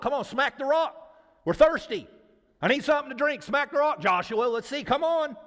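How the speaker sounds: high, urgent crowd demanding action; acted thirsty, pushy people; impatient goading. Crowd goading Joshua for water.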